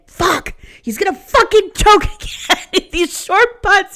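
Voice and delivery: high, loud reenacted cursing; strained, agitated yell, acting out a frustrated rant; pissed off. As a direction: curse loudly in high-pitched exasperation. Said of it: "I was getting pissed".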